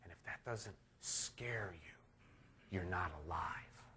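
whispered warning to the room; hushed warning. Real, not acted. Preacher warning his congregation in a whisper. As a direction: lean in and whisper the threat.

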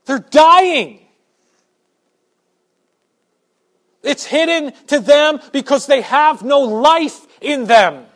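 loud, high preaching with building passion; impassioned preaching. Acted. Preacher pleading with his congregation, loud and high. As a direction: pour urgency into every line.

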